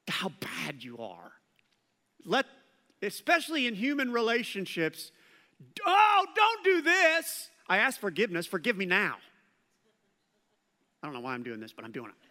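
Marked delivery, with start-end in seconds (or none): 5.9-9.2 high pleading mock apology; hammed-up pushy penitent. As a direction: whine for instant forgiveness. Pastor acts pushy apologizer.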